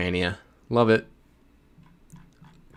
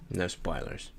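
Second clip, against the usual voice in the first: dropped to a near whisper, far softer; hushed; 10 dB quieter than usual. Shown as a demonstration. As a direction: say it again under your breath.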